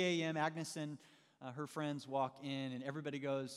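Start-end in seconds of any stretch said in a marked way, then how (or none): none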